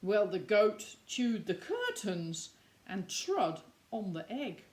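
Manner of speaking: plain reading voice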